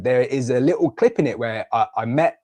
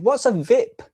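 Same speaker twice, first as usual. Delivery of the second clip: lightly voiced small child, higher and louder; a child's puzzled question, pitched up; imitating little Ivy; 11 semitones higher, 5 dB louder, slower than usual. Toddler daughter asking a question.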